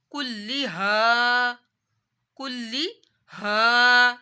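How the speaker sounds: كُلِّهَا very slowly, the final haa vowel drawn long; slow tajweed reading of كُلِّهَا with doubled lam; alif madd. Shown as a demonstration. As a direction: stretch the aa at the end of كُلِّهَا. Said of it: "الف سے پہلے زبر آ رہی ہے تو الف مدہ".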